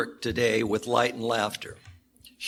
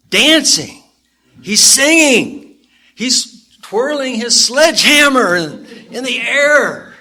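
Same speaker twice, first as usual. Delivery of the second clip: excited narration; 11 semitones higher, 8 dB louder than usual. A narrator's reading. Narrating joyful dancing.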